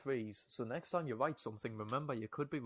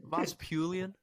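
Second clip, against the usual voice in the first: mock Liverpool accent, slightly higher; Liverpudlian accent; 5 semitones higher, 4 dB louder, slower than usual. Shown as a demonstration. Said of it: "he was making [name] Liverpoolian".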